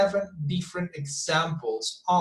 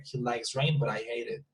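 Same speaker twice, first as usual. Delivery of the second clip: emotionless and softer, verbs unstressed; low-key, toneless delivery; no emphasis; 4 semitones lower, 7 dB quieter than usual. Shown as a demonstration. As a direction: recite it without stressing LIKES or HATE. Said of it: "I need to emphasize on the two verbs".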